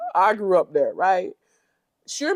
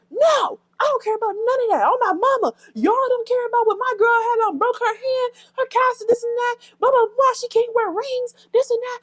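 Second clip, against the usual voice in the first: very high-pitched, louder indignant imitation; mock angry rant; 4 dB louder than usual. Vlogger re-enacts Summer defending her girl, high-pitched mock outrage.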